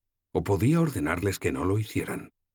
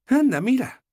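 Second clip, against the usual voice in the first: much higher and louder, bright exclamation; voiced young heroine, excited; delighted young woman; 8 dB louder than usual. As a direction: point at the sky in sudden delight. Sefawin voiced by narrator.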